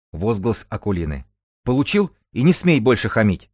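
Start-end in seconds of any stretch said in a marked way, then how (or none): 1.6-3.5 high, loud, angry telling-off; acted outburst, raised voice; indignant rebuke. A narrator's reading. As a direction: put him firmly in his place. Akulina rebuffs an attempted hug.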